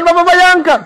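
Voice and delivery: put-on the old woman's plea at its peak; urgent pleading. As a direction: push the plea harder on the last repeat. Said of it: "Mama tuh tambah gas".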